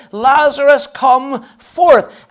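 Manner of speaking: big pulpit shout of command; commanding cry. Acted. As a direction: summon him with ringing authority. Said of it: "a voice that will wake the dead".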